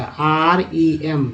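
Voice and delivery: letters spelled one by one, very slowly; slow spelling out. Shown as a demonstration. Teacher spelling the word for students, very slow. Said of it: "remember का".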